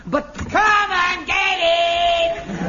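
slow, loud, booming bellow; huge resonant parade-ground bellow; booming shout. Acted. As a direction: roar it out like a sergeant major. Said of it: "Say that again".

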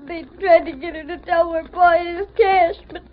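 put-on sobbing boy, stumbling over words; crying between phrases; tearful account. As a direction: tell it haltingly through tears. Crying boy tells of kidnapping.

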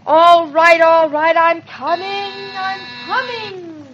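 harried sing-out to a visitor; calling out. Acted. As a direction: call ahead as you come, stretching the last word. Calling to someone at the door.

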